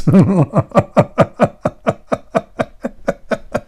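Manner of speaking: long wheezy high giggle; helpless laughter. Real, not acted. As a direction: crack up at your own punchline. Laughing at own joke.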